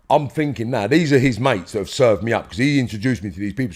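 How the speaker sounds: ordinary delivery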